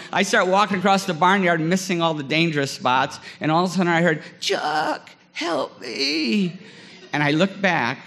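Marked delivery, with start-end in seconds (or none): 4.4-6.6 put-on a little boy's high, whispered-then-cried call for help; mock kid's cry for help. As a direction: squeak out the kid's plea, half-imitating him. Kid calling for help, retold.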